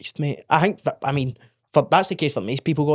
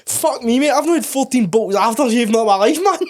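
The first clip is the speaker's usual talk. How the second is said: higher, louder shouted amazement; loud disbelief; 9 semitones higher, 5 dB louder than usual. Real, not acted. Reacting to his mate's fourteen aftershaves, shouting.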